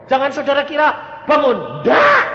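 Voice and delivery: high, loud preacher shout; shouted denial. Real, not acted. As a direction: slam the idea down hard. Emphatic denial to congregation. (teriakan pendeta yang tinggi dan keras)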